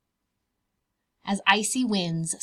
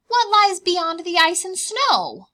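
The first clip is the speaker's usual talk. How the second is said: high, louder little cub asking; 10 semitones higher, 11 dB louder than usual. Acted. Bear cubs asking Mama, high child voice. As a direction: pipe up like a curious bear cub.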